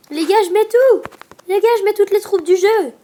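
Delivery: high, loud hyped shout; excited shout. Real, not acted. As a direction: yell it out, all in. All-in push, hyped.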